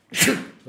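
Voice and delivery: sudden loud sneeze; short explosive sneeze. Real, not acted. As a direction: let out a real sneeze. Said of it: "I'm sneezing".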